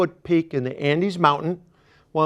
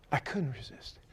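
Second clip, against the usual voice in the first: whispered repeat; whispered echo of the line; 4 semitones lower, 15 dB quieter than usual. Shown as a demonstration. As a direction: drop it to a whisper.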